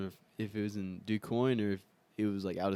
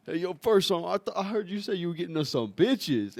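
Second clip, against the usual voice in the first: eager high call; 8 semitones higher, 6 dB louder than usual. Retold or imitated. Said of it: "I was like".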